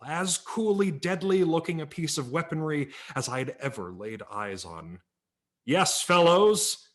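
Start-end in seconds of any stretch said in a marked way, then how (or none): 5.6-6.9 drawn-out, louder and higher cowboy hero; slow booming pitch to the boys; Red Ryder voice. Acted. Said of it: "Red Ryder continued".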